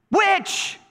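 high, urgent one-word plea; acted high-pitched impatience; exasperated demand. Praying person demands an answer.

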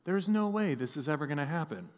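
low, soft doubtful aside; inner thought. Acted. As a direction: say it privately, under your breath. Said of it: "this is what I'm thinking in my head".

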